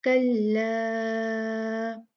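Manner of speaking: कल्ला with the long आ drawn out for four counts; madd stretched four counts. Shown as a demonstration. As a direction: hold the आ in कल्ला long and steady. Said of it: "इस मद को चार count खींचा".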